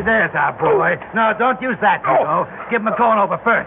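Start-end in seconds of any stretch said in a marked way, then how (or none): none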